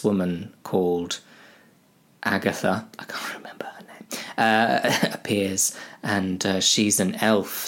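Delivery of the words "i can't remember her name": a hushed whisper to yourself; whispered aside. Real, not acted. Narrator forgetting the elf's name, whispered aside. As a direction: whisper it as you search your memory.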